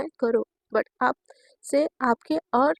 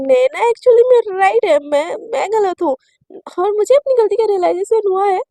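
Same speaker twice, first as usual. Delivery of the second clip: tearful pleading; 3 semitones higher, 8 dB louder than usual. Acted. Shivani pleading with mother.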